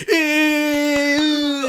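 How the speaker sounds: long, high, loud mock squeal; squealing kids. Kids reacting to teacher's girlfriend, mock shrill squeal. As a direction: imitate a gaggle of kids squealing. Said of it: "And they're like".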